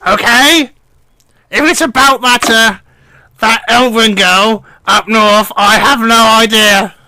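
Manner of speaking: put-on high, reedy old gnome voice, henpecked; old gnome mishearing superior as wife, high and fretful; old gnome lord. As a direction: whine it up high like a fussy old lord avoiding his wife. Gnome denies the elf girl.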